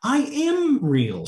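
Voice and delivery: high, drawn-out mild wail; tearful protest. A narrator's reading. Alice insists to the twins she is real, mildly tearful. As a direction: insist slowly on a high pitch, mock-tearful. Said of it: "and beginning to cry".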